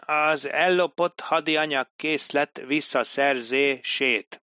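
"Az ellopott hadianyag készlet visszaszerzését" drawn out slowly, word by word; slow writing pace. Acted. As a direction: slowly spell out each word as the pen crawls. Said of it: "a toll nehézkesen mozdult a papíron".

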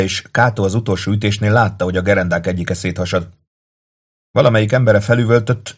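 ordinary delivery

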